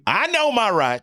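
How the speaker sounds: put-on drawn-out, slow Southern accent; a slow indignant Southern claim. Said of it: "with a Southern accent".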